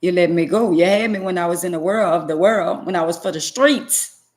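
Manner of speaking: loud, emphatic taunt to the devil; defiant challenge. Acted. Woman telling the devil he has lost her, defiant. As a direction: build to a forceful, preacher-like dare. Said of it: "So I tell the devil".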